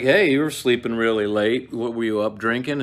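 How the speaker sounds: loud, jokey hey you slept late; loud mock scolding; Macduff teasing. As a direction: tease him loudly about drinking. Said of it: "he's just like".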